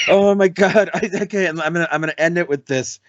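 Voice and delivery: ordinary delivery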